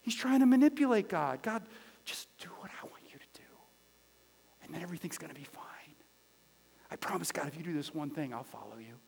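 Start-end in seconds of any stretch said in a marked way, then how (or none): 1.4-3.5 hushed, hurried whisper; whispered prayer. Acted. Man bargaining with God, whispering. 4.6-9.0 low, soft murmured promise; hushed bargaining prayer. Acted. Man promising God he will follow, murmured low.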